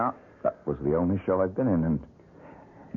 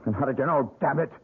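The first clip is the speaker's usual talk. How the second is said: angry demand; 7 semitones higher than usual. Acted. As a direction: pitch rising sharply on the challenge. Suspicious man confronts stranger.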